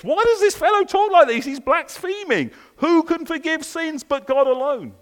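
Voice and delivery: high, loud scandalised objection; indignant teachers of the law. Acted. Preacher voicing the teachers of the law's outrage at Jesus, high and loud. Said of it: "Look what they're thinking".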